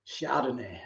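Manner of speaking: breathy whisper of the wine name. Real, not acted. Savouring the wine name in a whisper. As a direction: whisper it like a treat.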